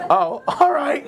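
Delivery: high-pitched, hesitant going along; lightly acted reluctant okay; uneasy agreement. Reluctant new hire's reply in story.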